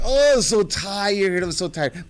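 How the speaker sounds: husband faking tiredness to avoid the day; fake tired. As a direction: whine it like faking exhaustion. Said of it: "But he really wasn't".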